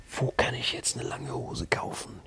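whispered, slow, desperate. Retold or imitated. Stranded traveller asking himself where to buy trousers, whispered. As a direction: whisper it to yourself in panic. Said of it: "ich so".

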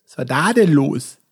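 slow TV host drawl; [name] impression. Shown as a demonstration. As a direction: do [name] asking what happened. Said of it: "das war [name]".

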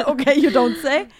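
laughing through the words. Retold or imitated. Telling her friend how she laughed off the boy at the car window, laughing. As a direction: giggle it out in relief. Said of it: "Und ich so".

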